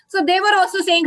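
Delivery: ordinary talk, nothing marked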